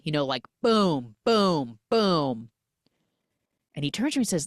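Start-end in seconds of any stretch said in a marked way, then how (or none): 0.6-2.5 loud booming knocks; heavy knocking. Retold or imitated. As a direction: boom it out like a fist on the door. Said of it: "knocks heavy".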